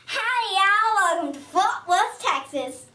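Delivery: put-on playful Texas accent. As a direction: greet them like a big Texan host. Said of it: "that was just my accent for Texas".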